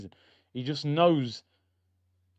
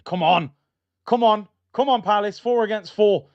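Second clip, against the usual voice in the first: loud, raised cheering-on; urging fan shout; 7 semitones higher, 9 dB louder than usual. Real, not acted. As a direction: yell at the team as the attack builds. Urging team on in attack.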